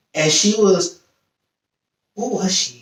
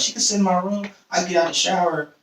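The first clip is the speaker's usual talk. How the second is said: normal talking voice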